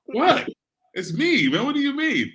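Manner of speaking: high-pitched, incredulous protest; baffled, squeaky objection to criticism; retold disbelief. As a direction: squeal it back in mock disbelief. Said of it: "And I'm like".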